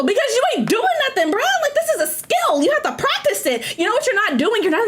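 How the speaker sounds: heated scolding rant. Real, not acted. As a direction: scold them, worked up. Ranting at bad authors.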